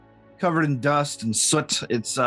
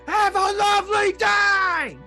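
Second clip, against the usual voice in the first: slow high booming ogre shout; ogre bellow; slower than usual. Acted. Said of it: "the ogre continues to bellow".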